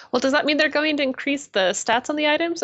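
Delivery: mimicked innocent query; imitating naive question. Retelling guildie's naive question to leader.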